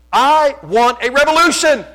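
rallying shout. Real, not acted. Sermon climax, rallying cry.

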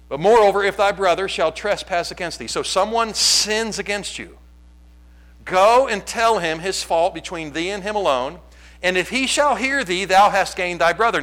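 loud, lifted scripture read. Acted. Pastor reading scripture aloud to church, proclaiming. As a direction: proclaim the verse to the congregation.

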